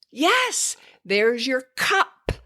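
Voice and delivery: high, bright model voice hitting cup; stressed, modelling cup. Shown as a demonstration. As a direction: sing out your cup for the toddler. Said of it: "And you stress it".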